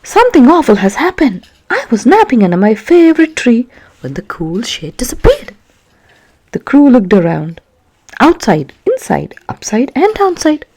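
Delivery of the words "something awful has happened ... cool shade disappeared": louder, higher panicky voice; a panicking bunny; panicked. Acted. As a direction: blurt it out in a panic.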